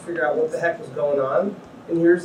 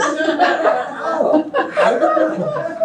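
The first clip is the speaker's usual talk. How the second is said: very high, slow, laughing through the words; laughing disbelief; 11 semitones higher, much slower than usual. Retold or imitated. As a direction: laugh it off, stunned. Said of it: "And I'm like".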